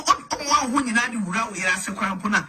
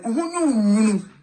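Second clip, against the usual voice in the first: normal talking voice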